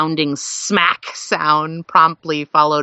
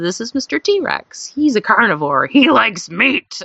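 mock menacing intro; 6 semitones higher than usual. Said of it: "and she said".

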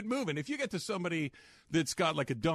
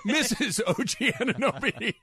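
laughing; 7 semitones higher, 6 dB louder than usual. Real, not acted. Mock announcing name with laughter.

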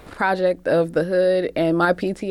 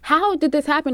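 higher-pitched mock disbelief; retold incredulous questioning; 7 semitones higher than usual. Retelling her challenge to the caller, incredulous.

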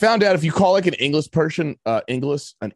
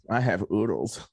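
softer, creepy Hannibal line; imitating Anthony Hopkins; 6 semitones lower, 6 dB quieter than usual. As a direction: purr it like a creepy doctor. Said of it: "Anthony Hopkins just goes".

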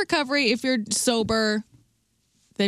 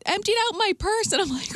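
usual speaking voice

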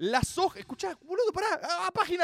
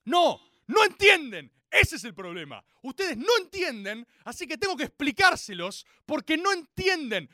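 loud mock-outraged insistence; exasperated rant; 4 semitones higher, 8 dB louder than usual. Fan scolding listeners who don't understand, exasperated rant. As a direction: punch it out, rising to a higher yell. Rants at audience for not understanding.

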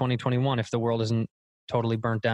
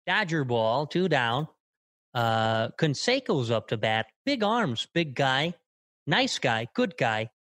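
snappy sportscaster lilt, up and down; old-school baseball play-by-play; Vin Scully impression; slower than usual. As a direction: call the game in quick, bouncy bursts. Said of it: "I used to do Vin Scully".

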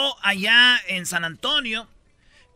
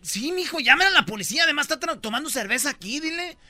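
put-on higher, pushy old mother; mother's voice; 6 semitones higher than usual. Mother urges the daughter to call the police. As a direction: egg him on like an older mom. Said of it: "también le dice".

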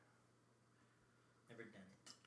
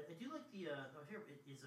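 ordinary talk, nothing marked

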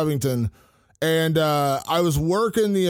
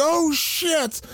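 retold scream; 11 semitones higher than usual. Sports fan recalling a knockout reaction in a hotel, mock scream. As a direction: exclaim it high, like a contained scream. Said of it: "I screamed, like, I reacted".

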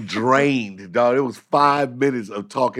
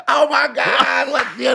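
mock exclamation; 7 dB louder than usual. Comic acting out the phone call, mock exclaiming. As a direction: stretch it out loud and high.

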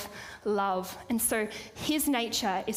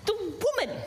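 stammering excuse; 6 semitones higher than usual. Retold or imitated. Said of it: "The man said".

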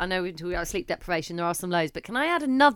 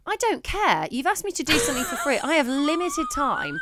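higher, fed-up "I don't care"; exasperated inner voice; 7 semitones higher than usual. Retold or imitated. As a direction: done with being asked for free work. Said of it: "you know, when you're like".

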